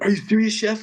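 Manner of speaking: excited. Retold or imitated. Students react to his news.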